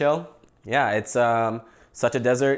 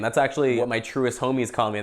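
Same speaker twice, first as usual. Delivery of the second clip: ordinary talk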